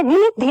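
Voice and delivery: निधि with a dragged nee; wrong long vowel. Shown as a demonstration. Teacher rejecting the wrong form, निधि drawn out. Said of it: "बड़ी ई की मात्रा नहीं लगेगी".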